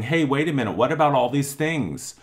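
the fearful inner voice pestering with memories; nagging fearful mind. Retold or imitated. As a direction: needle yourself with old worries. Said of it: "the mind is saying".

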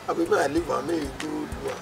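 very soft, subdued voice; low-energy, quiet resignation; resigned musing. Acted. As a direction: think aloud under your breath. Resigned muttering to himself.

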